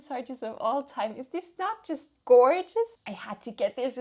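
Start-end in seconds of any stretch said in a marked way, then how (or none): 1.2-3.0 much higher, louder gushing over the cover; delighted gush. Real, not acted. Vlogger showing viewers the cover, excited gush. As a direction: show the book cover off with delight.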